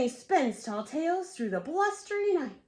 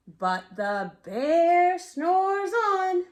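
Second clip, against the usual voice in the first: drawn-out slow refrain, louder; slow sleepy refrain; 4 semitones higher, 5 dB louder, much slower than usual. A narrator's reading. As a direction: stretch every word like a yawn. Refrain read to child.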